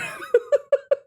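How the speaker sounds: short high giggle. Real, not acted. Laughing at freezing indoors.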